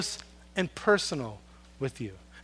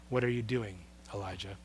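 much softer and lower, almost breathless; hushed voice of God; 10 semitones lower, 17 dB quieter, faster than usual. Acted. God calling Elijah in the cave, hushed and low. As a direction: speak it as the still small voice.

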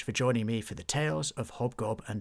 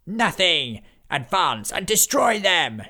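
loud, slow commanding shout; ordered; 8 semitones higher, 8 dB louder, slower than usual. Acted. Necromancer ordering his army to attack, shouting. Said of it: "he ordered".

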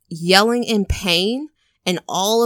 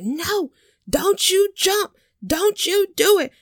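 high, slow pleading cry; high-pitched plea to the old man; pleading outsiders; 11 semitones higher than usual. Retold or imitated. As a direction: beg him not to jump in a high voice.

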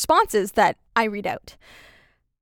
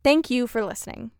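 ordinary delivery

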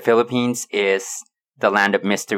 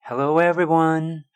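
put-on greeting voice; 7 semitones higher than usual. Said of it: "That was good?".

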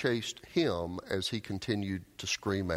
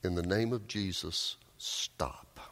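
low, hushed echo, whispering the last word; haunting echo; 4 semitones lower, 4 dB quieter than usual. Acted. Emperor keeps hearing it ringing in his ears, hushed echo. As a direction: let it fade into a whispered stop. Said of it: "he kept hearing ringing in his ears".

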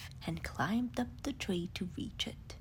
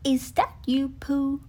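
louder, wondering question; curious Rabbit; 3 semitones higher, 8 dB louder than usual. Acted. Rabbit curiously asks the leaf pile if it is Pooh. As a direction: peer closer and ask.

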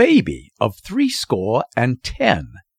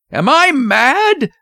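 loud, high, drawn-out outraged question; furious, incredulous father; thundering; 13 semitones higher, 5 dB louder, slower than usual. A narrator's reading. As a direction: thunder it as terror turns to rage. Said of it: "thundered Mister Button".

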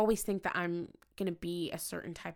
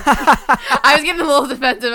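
loud laughing confession; 6 semitones higher, 21 dB louder than usual. Real, not acted. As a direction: laugh it out loudly. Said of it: "I was getting defensive".

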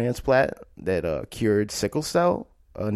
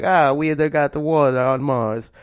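loud, higher mock Italian accent, badly done; horrible Italian accent; 5 semitones higher, 6 dB louder than usual. Telling listeners the Italian space agency found water, in a horrible Italian accent. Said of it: "that's a horrible Italian accent".

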